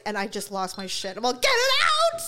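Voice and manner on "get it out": high, loud cry of grief; retold sobbing shout to get it out; anguished yell. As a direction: yell it through tears, pushing him away. Retold grief outburst at Daryl.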